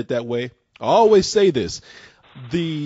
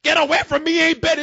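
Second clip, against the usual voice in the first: hammed-up high-pitched shouting; mock frantic outburst; 9 semitones higher than usual. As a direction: act out a panicked man lashing out. Acting out hallucinating man's outburst.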